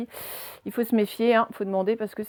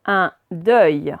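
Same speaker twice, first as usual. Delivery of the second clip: "Un deuil" as two separate, louder words; syllable by syllable; 3 semitones lower, 7 dB louder than usual. Shown as a demonstration. Teacher reading words aloud to pupils, syllable by syllable. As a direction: sound out "Un deuil" firmly, word by word. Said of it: "on va faire les deux d'un coup".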